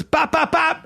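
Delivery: high quick mock punch noises; punch sounds. Buddy hits the guy, high mock punch noises. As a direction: pop out the punches.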